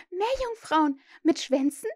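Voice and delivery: put-on high, wide-eyed girl's questions; amazed. A narrator's reading. Wendy hears of mermaids from Peter, amazed. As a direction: ask in high astonishment.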